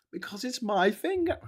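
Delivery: a lightly voiced old man's punchline; recalling the old man's joke fondly; repeating the old man. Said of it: "quoting the punchline again".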